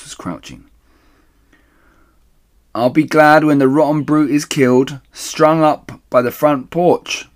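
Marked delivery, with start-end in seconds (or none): 2.7-7.3 loud, high, gloating call. Acted. The woman calls up gloating about the fox, loud and high. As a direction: relish the thought, call it up. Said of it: "she called out".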